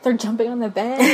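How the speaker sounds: laughing, rising surprised question; disbelieving, half laughing. Retold or imitated. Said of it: "I'm like".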